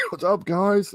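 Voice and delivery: high-pitched greeting in character; cheery Deadpool greeting. Acted. Deadpool dropping in on the mansion one day, cheery greeting. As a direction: toss out a high, breezy hello.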